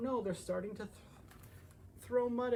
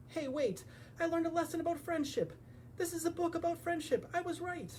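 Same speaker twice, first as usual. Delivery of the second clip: high, smug little hedgehog; hedgehog character voice; 8 semitones higher than usual. Acted. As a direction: sound pleased with yourself, pitched up.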